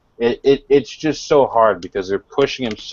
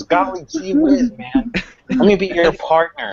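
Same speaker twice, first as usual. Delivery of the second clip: sixties Looney Tunes; 11 semitones higher than usual. Retold or imitated. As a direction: chirp it like a cheery cartoon kid. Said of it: "it's like sixties Looney Tunes".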